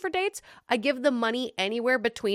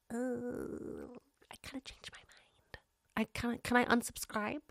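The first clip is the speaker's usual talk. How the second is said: whispered sheepish take-back; mock whisper; 13 dB quieter than usual. Mocking a spouse who wants out, in a sheepish whisper. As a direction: whisper it like a guilty confession. Mocking people backing out of marriage.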